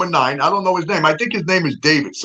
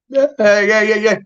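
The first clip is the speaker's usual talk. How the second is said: bright, louder, higher mock of her eager yeahs; 6 semitones higher, 3 dB louder than usual. Narrator imitating Madison's giddy yeahs at the hug, higher and louder. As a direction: toss off the yeahs like a giddy girl. Madison hugging the jersey guy.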